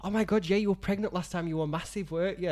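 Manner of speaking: gushing over-eager. Retold or imitated. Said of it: "to try and make it up".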